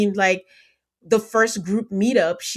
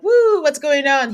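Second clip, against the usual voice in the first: mock nervous cheer; 6 semitones higher, 6 dB louder than usual.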